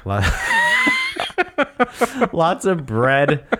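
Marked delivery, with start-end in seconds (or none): 2.3-3.4 laughing. Real, not acted. Laughs mid-answer.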